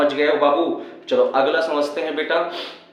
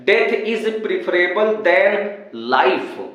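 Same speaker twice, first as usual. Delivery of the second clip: drawn-out reading of the question; slow dictation; 4 semitones higher, much slower than usual. Shown as a demonstration. Teacher slowly reading the question aloud. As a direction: read "Death is preferable than life" very slowly, higher. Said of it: "क्या लिखा है".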